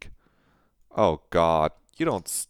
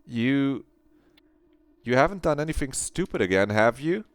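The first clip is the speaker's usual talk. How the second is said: higher, fast alarmed question; worried; 7 semitones higher than usual. Acted. Jamie asking Claire about self-harm, fast and worried. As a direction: press her, anxious and quick.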